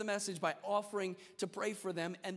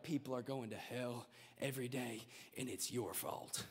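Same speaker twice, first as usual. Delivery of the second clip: much lower, softer doom-laden preacher; imitating evangelist; 8 semitones lower, 8 dB quieter than usual. Mocking the heavy evangelist blaming the crowd, low and grim. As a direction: mimic a grim old evangelist. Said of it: "The evangelist would come in, he'd be like".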